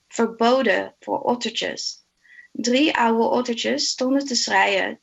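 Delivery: measured, rhythmic recital in Dutch with a steady lilt; Dutch poem recitation. Acted. Reading a favourite Dutch children's poem to colleagues, in a rhythmic recitation. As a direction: read the rhyming verse aloud, savouring each line.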